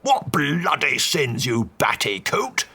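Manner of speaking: put-on slow, furious old Duke; angry Duke insulting the priest; raging. A narrator's reading. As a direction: blast the priest with scorn. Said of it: "raged the Duke".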